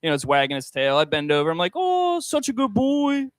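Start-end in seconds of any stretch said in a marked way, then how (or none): 1.8-3.3 put-on high, slow baby talk to a dog; sing-song doggy praise; cooing at a dog. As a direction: coo it like praising a puppy.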